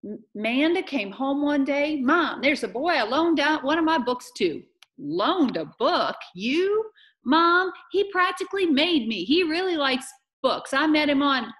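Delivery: high, loud, singsong mimicry; animated re-enactment. Missus Beale teasingly replays her daughter's story, animated re-enactment. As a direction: act out both sides with exaggerated liveliness.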